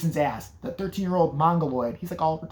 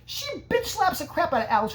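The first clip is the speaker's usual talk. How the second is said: normal talking voice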